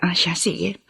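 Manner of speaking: barely voiced, hushed "sige"; whispered agreement. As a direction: let it slip out in a whisper. Quiet reluctant agreement. (pabulong na pagsang-ayon)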